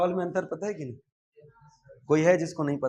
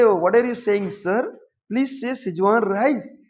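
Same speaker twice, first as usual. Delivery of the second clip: higher, very slow English, a mock correcting waiter; 6 semitones higher, 4 dB louder, much slower than usual. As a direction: play the waiter who corrects you, slowly. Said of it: "ऐसे बोलेंगे".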